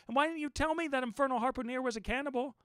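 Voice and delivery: high, loud indignant shout. Acted. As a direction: angrily demand an answer. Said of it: "shouted I".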